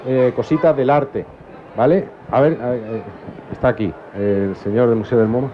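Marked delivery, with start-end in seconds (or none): none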